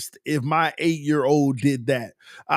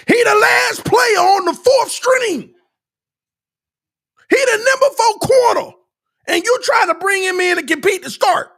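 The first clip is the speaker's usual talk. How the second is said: high, loud shouting rant; furious rant; 12 semitones higher, 6 dB louder than usual. Real, not acted. Fan ranting at the front office, loud and high. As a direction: shout it out in disbelief. Ranting about the cornerback signing.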